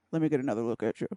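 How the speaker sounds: lips barely moving, low; talking with mouth closed. Shown as a demonstration. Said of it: "say it without moving your lips".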